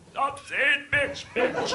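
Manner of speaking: high, slow squeaky voice, laughing; mock frog voice. Comedian answering roommate in a high frog voice. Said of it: "I just go".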